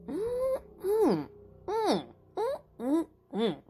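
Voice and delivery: high delighted hums; yummy mmm sounds; savoring mm. Acted. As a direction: hum with delight at the taste. Tasting honey.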